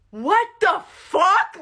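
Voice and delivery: very loud, high, stretched-out disbelief; flabbergasted yell; shocked outburst. Real, not acted. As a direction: shout it in utter bafflement. Teller's shocked reaction to coconut.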